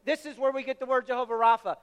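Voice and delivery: ordinary talk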